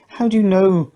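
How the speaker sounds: put-on a girl pressing for an answer; young girl asking. A narrator's reading.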